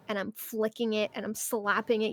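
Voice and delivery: normal talking voice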